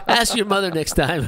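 loud, laughing punchline. Real, not acted. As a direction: laugh out the joke.

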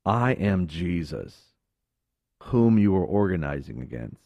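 voice of Jesus. Acted. Jesus answers Saul on the road, low and slow.

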